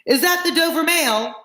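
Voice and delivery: high voice calling from afar. A narrator's reading. Rider in the mist to the coach, calling. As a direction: hail them in a high, stretched call. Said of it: "a man's voice called from the mist".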